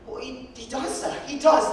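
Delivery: insist, then burst out; insistent orphan boy. Acted. Orphan boy insists his father is rich, rising to a loud protest.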